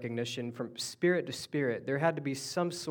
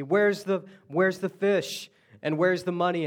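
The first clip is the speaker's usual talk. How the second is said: louder, higher, demanding wife's voice; mock a nagging wife asking for money; 5 semitones higher, 7 dB louder than usual. Husband imagines his wife demanding fish and money. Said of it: "she's gonna ask me".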